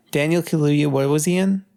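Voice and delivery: ordinary talk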